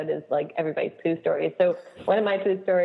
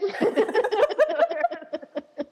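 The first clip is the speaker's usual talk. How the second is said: laugh. Real, not acted. Laughing after the child's confession.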